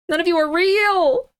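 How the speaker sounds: high, wailing dramatic accusation; escalated accusation. Real, not acted. Streamer crying out that the listings are fake, distraught.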